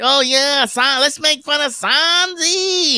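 high, slow, dopey mock fan. Mocking Red Bar fans who joined the joke, high and slow. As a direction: sneer at them by playing them high and slow. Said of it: "That you were like".

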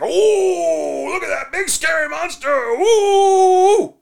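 very high, loud mock-terrified squeal; mock scared dad. Father mocking his son's fear, squealing high. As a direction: ham up fake fright to tease the kid. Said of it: "the dad's, like, playing it up".

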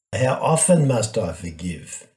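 ordinary talk

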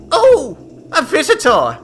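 very high, loud, halting mad wizard; Franklin, mad delight. Acted. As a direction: greet a visitor like a delighted lunatic.

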